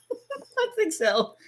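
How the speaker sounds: drawn-out, high giggly "I think so"; laughing. Real, not acted. Laughing at herself over the weekday, slowly and high.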